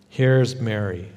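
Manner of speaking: normal talking voice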